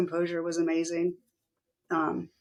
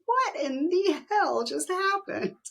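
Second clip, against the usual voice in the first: slow, much higher, baffled retelling; stunned disbelief; 11 semitones higher, much slower than usual. Retold or imitated. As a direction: ask it slowly, still not believing it. Stunned after his sudden switch.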